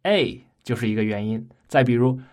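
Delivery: usual speaking voice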